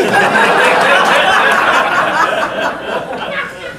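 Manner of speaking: long, high mock cackle; imitated cackling laugh. Waitress cracking up at her own line, long imitated laugh. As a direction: let a high laugh run on and on. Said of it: "She starts laughing".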